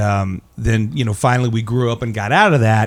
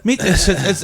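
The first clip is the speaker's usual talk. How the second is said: usual speaking voice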